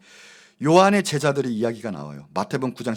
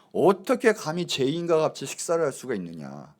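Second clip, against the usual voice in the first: ordinary delivery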